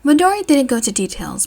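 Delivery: ordinary delivery, nothing marked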